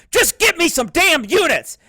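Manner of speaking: loud, high mock outburst; hammed-up angry yell. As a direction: imitate him losing it, yelling. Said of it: "And he just goes off".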